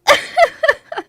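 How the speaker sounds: put-on high, loud childish cackle; mock giggle. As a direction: laugh like a kid at a rude pun. Mocking childish laughter.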